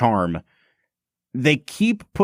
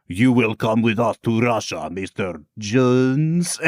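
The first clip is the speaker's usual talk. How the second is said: put-on slow, hammy Russian accent, "Jones" drawn out with a long rounded O; mock Russian accent; 2 semitones lower, slower than usual. Imitating the villain ordering Indiana Jones to Russia, hammy slow Russian accent. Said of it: "she'll be like".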